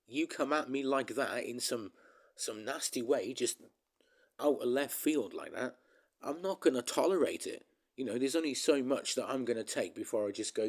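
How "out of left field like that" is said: ordinary delivery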